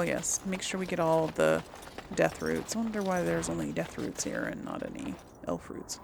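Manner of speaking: ordinary talk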